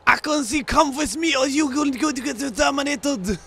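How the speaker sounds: badly faked Terminator accent, pitched high; muddled foreign accent, sliding towards mock Japanese; bad film accent. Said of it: "bad Japanese slash Chinese accent".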